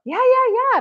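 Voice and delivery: imitated high, eager chorus of agreement; eager welcome. Group welcoming a nervous newcomer, acted eager and high. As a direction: pitch way up, hurry to reassure.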